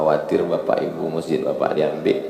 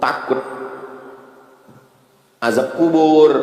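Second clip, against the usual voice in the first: high, loud, rising litany of fears; impassioned preaching; 8 semitones higher, 3 dB louder than usual. Acted. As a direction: hammer each fear home. Preacher lists fears, builds intensity.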